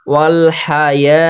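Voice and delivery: ওয়াল হায়া slow, with "yaa" stretched; long-drawn vowel. Shown as a demonstration. Teacher demonstrating the stretch, long "yaa" in ওয়াল হায়া. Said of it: "এক আলিফ টানবো".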